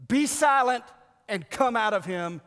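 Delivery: loud, high stern command; Jesus rebuking the demon, booming; commanding rebuke. Acted. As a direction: order the spirit out with full force.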